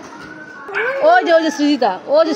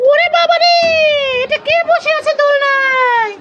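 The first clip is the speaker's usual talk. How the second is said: ছোট বাচ্চার চরিত্র, অবাক; 9 semitones higher than usual. Acted. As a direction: squeal in alarm as a little one. (বাচ্চার গলায় টেনে টেনে অবাক হয়ে বলা)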